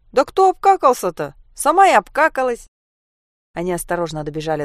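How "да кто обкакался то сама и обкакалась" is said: put-on loud, high-pitched childish protest; indignant retort. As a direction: throw the accusation straight back. Children bicker over an accusation. (возмущённо, громко и высоко огрызнуться)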